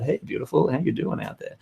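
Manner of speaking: soft, half-whispered flirty greeting; flirty come-on. Acted. Speaker voicing an intimate focus to the audience, soft and flirty. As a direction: murmur it like a gentle chat-up line. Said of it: "or you're focusing about".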